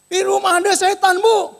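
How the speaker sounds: high, loud panicked cry; hammed-up terrified maid on the phone; mock frightened crying. Maid telling her employer of a devil, imitated panic. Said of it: "nangis-nangis, ketakutan".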